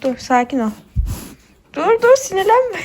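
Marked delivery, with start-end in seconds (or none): 1.7-2.9 high, slow words through laughter; laughing. Real, not acted. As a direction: soothe the calf while laughing. Laughing while calming calf. (gülerek yatıştırma)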